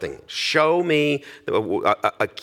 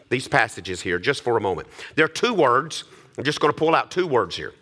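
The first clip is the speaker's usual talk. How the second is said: ordinary talk, nothing marked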